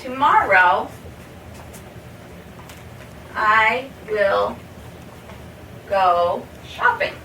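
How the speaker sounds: drawn-out words with pauses; slow model sentence. Shown as a demonstration. Teacher to learners, slow future-tense example. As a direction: say it slowly for the class. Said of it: "The same way".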